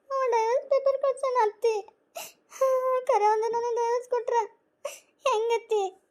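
high tearful wail; crying. Acted. Wife crying to her mother-in-law over the divorce papers, sobbing. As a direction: sob through the words, voice pitched high. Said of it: "ಎದಕ್ ಅಳ್ತಿ?".

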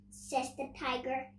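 plain reading voice

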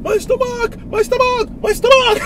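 high, rising comic cries; tortoise shouting. Pretend. Father tells the tortoise story to his child, high comic cries. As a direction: wail like a silly tortoise. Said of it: "he started shouting".

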